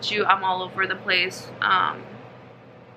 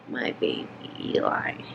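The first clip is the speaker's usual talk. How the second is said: whispering over a crib; 7 dB quieter than usual. Real, not acted. Mother whispering to sleeping newborn.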